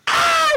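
very high mock shriek; imitated scream. Dad retelling his family's panic, mock shriek. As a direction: let out a comic squeal of panic. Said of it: "so they're screaming like".

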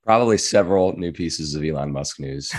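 ordinary talk, nothing marked

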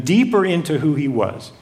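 ordinary talk, nothing marked

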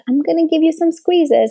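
high, sing-song promise of squeezes; playful to child. Shown as a demonstration. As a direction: coax a little one with a lifted voice. Parent speaking playfully to child.

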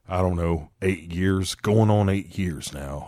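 put-on low drawling old mechanic; old Southern man voice. Imitating Duvall talking to car.

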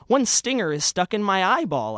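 comic pained outcry; pained cry. Acted. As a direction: cry out as if stung in the eye. Narrator stung, crying out.

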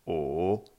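O dipping down then rising; "O" in a V-shaped contour; third tone. Shown as a demonstration. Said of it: "Avec le son O".

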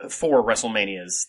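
ordinary delivery

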